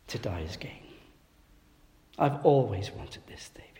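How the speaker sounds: breathy whisper of surrender; whispered prayer. Retold or imitated. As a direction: whisper it as a last private word to God. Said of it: "he was saying to himself".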